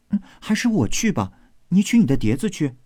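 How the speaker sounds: high maid voice, quick finish; lightly voiced eager maid. Maid offering to go instead, high and quick.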